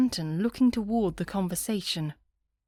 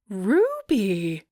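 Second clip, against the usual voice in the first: loud delighted cry of a name; enthusiastic; 6 dB louder than usual. A narrator's reading. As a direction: call out the name with a burst of joy. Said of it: "with so much enthusiasm".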